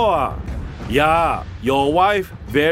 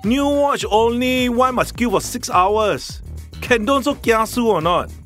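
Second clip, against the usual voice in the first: annoyed Singlish complaint; singaporean accent; 3 semitones higher than usual. Shown as a demonstration. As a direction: grumble about queuing in Singlish. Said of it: "A typical use of the term in a sentence would be".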